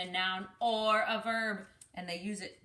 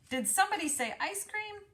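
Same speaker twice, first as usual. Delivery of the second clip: high eager call; perking up at ice cream; eager arrival; 11 semitones higher, 3 dB louder than usual. Acted. Uncle enters eagerly.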